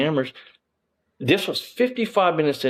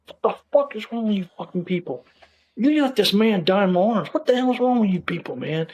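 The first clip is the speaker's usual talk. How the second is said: furious shout; 9 semitones higher than usual. Retold or imitated. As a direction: blow up at them in fury. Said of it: "I was livid".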